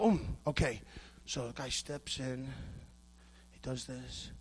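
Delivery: very low, hushed murmur to himself; study a move, talking softly under your voice; quiet self-talk. Acted. Judo student studying a video, hushed self-talk.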